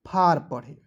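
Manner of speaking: फार, the correct way; फार said long and open; right pronunciation. Shown as a demonstration. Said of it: "या".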